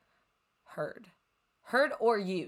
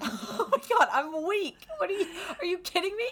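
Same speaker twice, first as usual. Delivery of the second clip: excited shriek; 11 semitones higher, 4 dB louder than usual. Real, not acted. Shocked by fight question.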